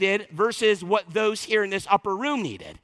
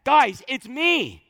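"Guys, it's me" slow, a touch higher and louder; emphatic it's me; 4 semitones higher, 3 dB louder, slower than usual. Acted. Preacher acting Jesus assuring the disciples, slow and emphatic. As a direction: land the me like an exclamation mark. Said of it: "there's an emphasis".

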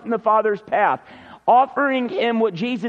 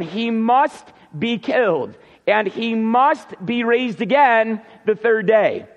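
slow reading, each MUST stressed; measured pace, weight landing on "must"; emphatic MUST; slower than usual. Shown as a demonstration. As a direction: drive home each MUST, slowly. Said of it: "there's more emphatic language to the text".

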